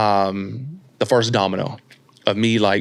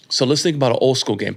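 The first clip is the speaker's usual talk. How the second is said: normal talking voice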